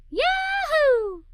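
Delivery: high, loud yahoo of delight; excited cheer; joyful whoop. Acted. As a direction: whoop it out, thrilled. Joyful cry at music.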